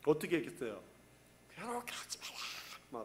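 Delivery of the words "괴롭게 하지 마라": slow, voiceless strangled cry; grotesque demon shriek. Acted. Preacher acts the demon's tormented cry, strangled and voiceless. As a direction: force it out as a drawn-out hushed scream. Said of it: "이 괴악스러운 소리를 막 질러댔을".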